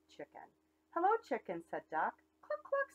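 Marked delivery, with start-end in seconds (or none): 0.9-1.6 high, bright little duck greeting; cheery duck. Acted. Duck greets the cow from his bike, high chirpy hello. 2.4-2.9 very high clucking; mock hen clucks. Chicken answers the duck, high clucks.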